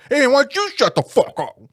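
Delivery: loud, high mock yell at a heckler; retold shout. Telling the heckler to shut up, loud shout. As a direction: bark it across the room.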